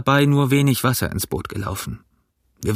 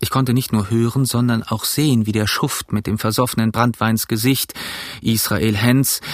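plain reading voice

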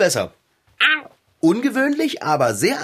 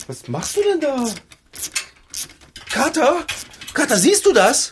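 higher, amazed cry to the cat; stunned, calling out to Kater; astonished call; 10 semitones higher than usual. Acted. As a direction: call the cat over in disbelief.

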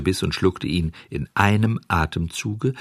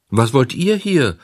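put-on high, alarmed puppet-boy question; high-pitched frightened demand; startled cry; 6 semitones higher, 5 dB louder than usual. A narrator's reading. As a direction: call out in sudden fright. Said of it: "rief Bengele und setzte sich voller Schrecken".

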